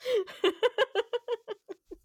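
tipsy high-pitched laugh; high giggle. Real, not acted. Laughing at partner.

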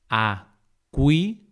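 acuífero broken slowly into a, cuí, fe, ro; syllable by syllable. Shown as a demonstration. Teacher dividing acuífero for learners, syllable by syllable. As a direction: say "acuífero" as four separate syllables.

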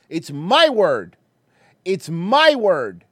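MY stretched, high and loud; insistent stress on MY. Pretend. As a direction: lean hard and long on "my". Defiantly defending own joke.

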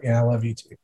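a low, soft, pitying "love you too"; pitying reply. Retold or imitated. Husband mimics his wife answering him, low pitying tone. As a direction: imitate the wife's flat dutiful pity. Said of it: "pity that I hear in my wife's voice".

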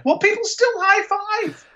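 very high, squealed objection; high-pitched comic whine; mock protest.

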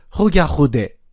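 ホギャホデ with Japanese h sounds replacing French r; learner's mispronunciation. Shown as a demonstration. Teacher showing what reading katakana leads to, mispronounced ホギャホデ. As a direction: say ホギャホデ as a struggling learner would. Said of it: "のようになってしまいます".

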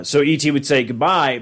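usual speaking voice

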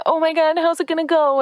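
high, worried panicky voice; imitating a fretting client; mock anxious client. Client spinning in worry.

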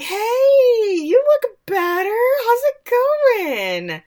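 hammed-up chirpy greeting; cheery priest. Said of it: "And Father's like".

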